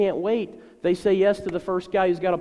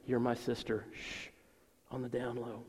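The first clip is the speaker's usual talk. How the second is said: conspiratorial hush; 5 semitones lower, 9 dB quieter than usual. Retold or imitated. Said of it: "So he says".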